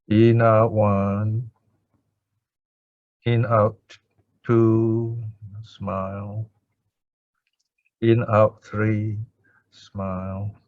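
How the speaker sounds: slow, measured counting with long pauses; guided breath count. Shown as a demonstration. Teacher showing students how to count breaths, slow meditative counting.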